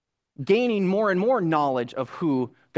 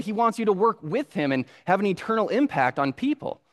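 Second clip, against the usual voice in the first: usual speaking voice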